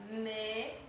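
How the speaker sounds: MESA split slowly into "me" and "za", with a gap; syllable by syllable. Shown as a demonstration. Teacher showing two syllables, mesa broken into me, za. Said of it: "duas sílabas".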